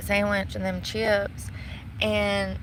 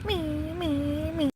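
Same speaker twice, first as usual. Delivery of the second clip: engine idling sound; 6 semitones higher, slower than usual. Retold or imitated. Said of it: "trying to make the engine noise".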